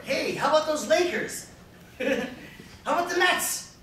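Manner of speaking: loud, high-pitched sports banter; enthusiastic small talk. Shown as a demonstration. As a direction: break the ice like a buddy, loud and high. Acting out sports small talk on phone.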